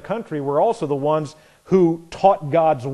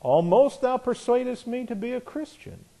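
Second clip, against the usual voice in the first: Agrippa voiced; 8 semitones higher, 4 dB quieter than usual. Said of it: "Agrippa said unto Paul".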